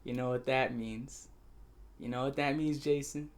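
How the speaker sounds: low, soft, knowing taunt; quiet mock menace. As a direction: drop your voice and toy with him. Retelling his menacing taunt to Jason.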